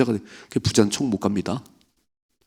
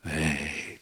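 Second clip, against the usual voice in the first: ordinary talk, nothing marked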